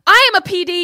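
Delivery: loud, high mock declaration; imitating a woman claiming she's police; mock authority. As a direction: bark it like a fake cop. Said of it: "And she's like".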